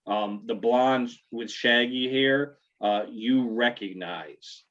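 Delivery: usual speaking voice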